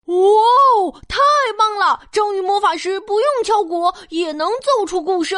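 loud, thrilled exclaiming; amazed cheering. Acted. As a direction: wow them with delight. Audience amazed by drum magic.

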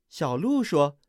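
ordinary delivery, nothing marked

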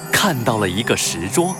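usual speaking voice

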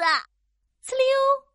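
high, loud slurp noise; slurping sound effect. Acted. Child sucking up a noodle, high loud slurp.

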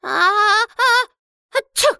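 high wind-up into a sneeze; about to sneeze. Acted. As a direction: build up and sneeze. Sneezing dwarf.

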